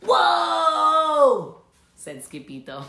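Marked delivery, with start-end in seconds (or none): 0.0-1.6 high, loud, stretched whoa; amazed shout. Acted. Kitty boy stepping into the jungle closet, amazed shout. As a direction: shout in wonder.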